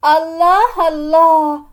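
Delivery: slow, loud drawn-out exclamation; sarcastic surprise. Acted. Sister arriving to the quarrel, slow loud sarcastic surprise. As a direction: stretch it out in mock shock.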